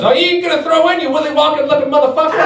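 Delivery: high, far-off mock heckler voice; homeless man heckling. Homeless man heckling him from across the street, high mock yell. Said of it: "and he goes".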